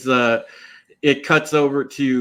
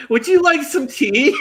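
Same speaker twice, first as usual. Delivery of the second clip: high-pitched mock-genteel tea offer; mock-polite offer; 12 semitones higher than usual. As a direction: play the over-polite hostess, pitched way up. Mimicking a character's sweet tea offer.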